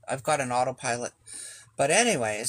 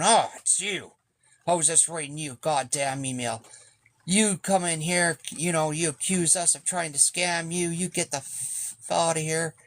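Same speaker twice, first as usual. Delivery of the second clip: imitating an irate man, pitched up; mock-angry telling-off, higher than usual; angry confrontation; 4 semitones higher than usual. Office man tells boat owner to get out, angry confrontation.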